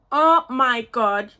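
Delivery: loud, slow, awestruck oh my God; thrilled oh my God. Real, not acted. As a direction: let the delight burst out, stretched. Smelling the perfume again.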